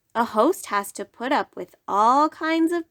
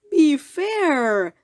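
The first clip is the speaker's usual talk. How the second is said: mild begging; 5 semitones higher, 4 dB louder than usual. Acted. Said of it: "Thidwick begged".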